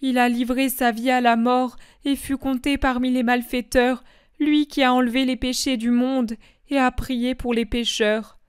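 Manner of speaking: ordinary delivery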